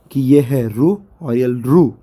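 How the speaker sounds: usual speaking voice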